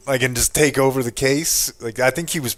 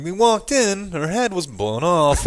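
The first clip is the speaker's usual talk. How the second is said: slow, higher-pitched mock news read; imitating news reporter; 8 semitones higher, slower than usual. Co-host mocking how she read graphic details, exaggerated reporter cadence. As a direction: mimic a reporter's drawn-out delivery of grim details. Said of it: "she did read the graphic details like that".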